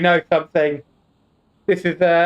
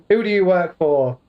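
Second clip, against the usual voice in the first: ordinary delivery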